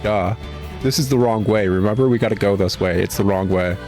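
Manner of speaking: ordinary delivery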